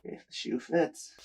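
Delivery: hushed self-mocking whisper; whispered aside. Real, not acted. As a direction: whisper it to yourself.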